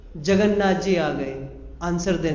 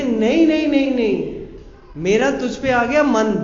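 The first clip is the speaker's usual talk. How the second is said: insistent pleading; 4 semitones higher, 4 dB louder than usual. Acted. Woman pleads, insists on Sadna.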